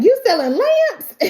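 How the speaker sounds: very high, loud disbelieving squawk; mother mocking her son's lamp job, incredulous; incredulous mom. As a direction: scoff in disbelief.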